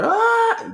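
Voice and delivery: a sudden high, loud whooping ah. Shown as a demonstration. Ends the chiefing demo for students with a loud whoop. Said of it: "I'm about to do one of the characteristics".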